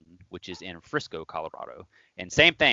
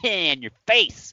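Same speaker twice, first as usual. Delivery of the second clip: mock gloat; 7 semitones higher, 3 dB louder, slower than usual. Gloating as friend falls.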